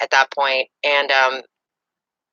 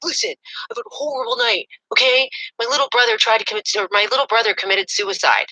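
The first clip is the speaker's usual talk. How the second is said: higher, strained retelling of his excuse; upset boyfriend; 7 semitones higher than usual. Retold or imitated. Boyfriend excusing his lateness, high strained imitation. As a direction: play the boyfriend's dramatic plea. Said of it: "And he's like".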